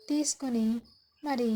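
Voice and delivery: ordinary delivery, nothing marked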